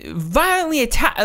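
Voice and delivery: high-pitched mock hysteria; freaking-out caller. Woman calling the police on a man, mock freak-out. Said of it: "just freaking out".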